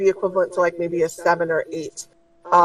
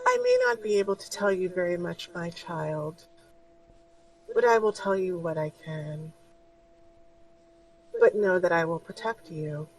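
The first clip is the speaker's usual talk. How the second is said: soft, much quieter motherly voice; gentle spirit guide; 7 dB quieter than usual. Acted. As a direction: reassure her tenderly. GM holds the guide's voice.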